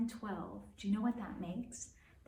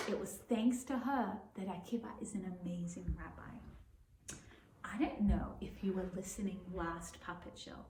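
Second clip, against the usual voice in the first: plain reading voice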